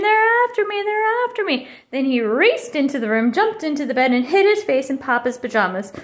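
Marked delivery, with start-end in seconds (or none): 0.0-1.7 high, urgent repeated cry; panicked acted yelling, pitched way up; storybook scream. A narrator's reading. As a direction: cry out as if chased from a bad dream. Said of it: "he screamed".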